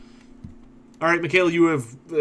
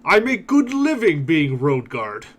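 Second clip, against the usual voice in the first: slow, louder boastful ogre; 4 semitones higher, 5 dB louder, slower than usual. Acted. Ogre road guard boasting to the party, slow and proud. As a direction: brag about the job like a proud brute. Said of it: "He laughs and slaps his belly and says".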